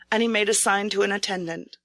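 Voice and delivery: ordinary delivery, nothing marked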